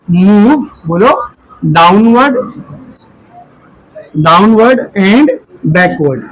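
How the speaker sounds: word by word, long gaps; deliberate, drawn-out terms, pausing for writers; slow dictation. Real, not acted. Teacher dictates rib cage movement to students, slow and segmented.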